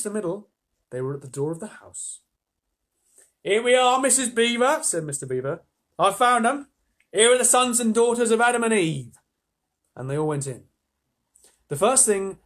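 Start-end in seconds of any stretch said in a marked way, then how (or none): 3.4-4.8 loud, high Cockney holler. A narrator's reading. Mister Beaver calling to his wife at home, loud Cockney. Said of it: "I'm allowed to do Cockney for him". 6.0-9.2 high, booming Cockney announcement. A narrator's reading. Mister Beaver presenting the children to his wife, proud Cockney. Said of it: "I'm allowed to do Cockney for him".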